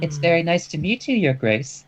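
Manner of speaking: high, sweet princess voice; curtsy with a dainty princess voice; cartoon princess. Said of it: "in the princess's voice".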